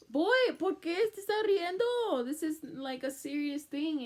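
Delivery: high, loud, mock-annoyed telling-off; exasperated scolding. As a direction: scold him for laughing at something serious. Scolding Danny for smiling.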